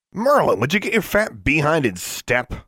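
high-pitched, irritated rebuke; snapped. A narrator's reading. Student scolding a stumbling sixth grader in drill, snappish. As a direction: snap it at someone out of step. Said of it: "snapped Adela Iturbide".

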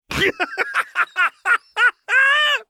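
high wild cackling laugh; maniacal cackle. A narrator's reading. Jinx cackles at Lux's slip, maniacal. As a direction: let a manic cackle rip. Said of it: "she cackled maniacally".

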